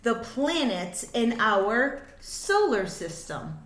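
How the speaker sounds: lively, sing-song picture book reading; reading to children. A narrator's reading. Reading a rhyming space book to kids, sing-song. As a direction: bounce the rhymes for little listeners.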